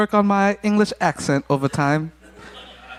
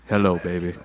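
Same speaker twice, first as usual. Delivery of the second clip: deep radio voice; 11 semitones lower, 4 dB quieter than usual. Pretend. Showing off how the mic deepens his voice, low radio croon. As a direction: voice dropped low and rich. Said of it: "The mic adds ten pounds to my voice".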